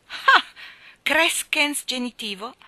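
high, loud mocking exclamation; derisive sneer. A narrator's reading. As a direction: scoff at her mistake. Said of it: "con un ghigno di derisione".

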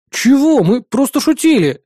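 high, mildly outraged protest; indignant. A narrator's reading. Narrator protesting to the woman that it was joking, indignant. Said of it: "возмутился я".

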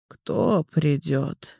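slow, numb-lipped mumble; numb lips. Acted. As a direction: speak as if lips have gone numb. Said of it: "внезапно онемевшими губами".

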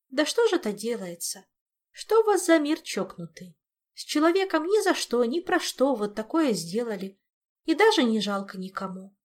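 high, loud reproachful outburst; indignant lament. Acted. Olga reproaching a cruel crazy world, high indignant lament. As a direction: protest it with aggrieved pity.

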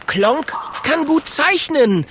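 high, drawn-out little dragon call. A narrator's reading. Kokosnuss steps up to save Klonk, calling out. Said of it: "tritt Kokosnuss vor und ruft".

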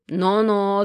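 a booming, drawn-out "no-no"; booming warning. A narrator's reading. Genych cutting Sasha off with a booming warning. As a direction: rumble it to cut her off. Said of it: "загудел Геныч".

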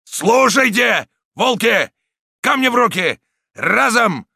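loud, high, spaced-out commands; full-lung bellow. A narrator's reading. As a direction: roar orders to the pack. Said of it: "оравшего во всю силу".

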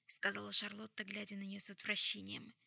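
ordinary delivery

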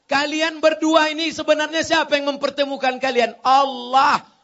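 loud, high rhetorical question with emphatic answer; impassioned preaching. Real, not acted. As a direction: ring it out to the crowd. (ceramah lantang penuh tekanan)

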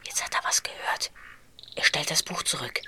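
hushed whisper so nobody hears. Acted. Boy whispering to his friend while spying. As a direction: breathe it out, hiding from Jensen.

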